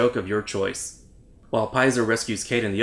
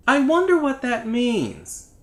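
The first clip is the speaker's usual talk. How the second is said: high, slow dopey voice; drawn-out squeaky musing; high slow robot voice; 11 semitones higher, slower than usual. Pretend. Dubbing robot line.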